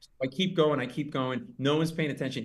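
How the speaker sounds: usual speaking voice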